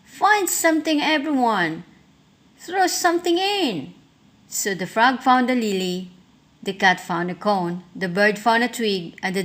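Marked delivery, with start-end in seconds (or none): 0.0-3.9 high, bright cheerful call; grinning witch. A narrator's reading. Witch invites everyone to fill the cauldron, grinning. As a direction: call the friends eagerly. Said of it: "with a grin".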